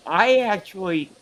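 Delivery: hiccuping mid-sentence; talking through hiccups. Real, not acted. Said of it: "I'm, I'm hiccuping hot dogs".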